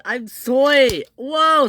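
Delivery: high, loud panicky cries; alarmed yell. A narrator's reading. Ferdinand falling, alarmed yell. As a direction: cry out as you topple.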